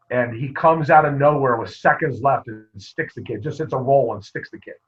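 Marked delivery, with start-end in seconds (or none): none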